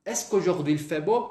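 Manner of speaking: "Est-ce qu'aujourd'hui il fait beau" with "beau" rising; questioning intonation. Shown as a demonstration. Teacher repeats the French question for students, rising intonation. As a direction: ask it in French, lifting the final "beau". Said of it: "Vou fazer uma pergunta".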